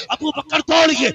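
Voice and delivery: high, loud urgent summons; urgent call. Acted. Urgent call to fetch Owais.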